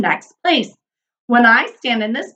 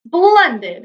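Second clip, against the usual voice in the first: exclaimed; 6 semitones higher, 3 dB louder than usual. A narrator's reading. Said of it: "exclaimed the king".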